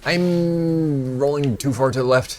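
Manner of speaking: high, loud, slow wail; alarmed whine. Real, not acted. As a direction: stretch it out in panic. Alarmed while game character rolls.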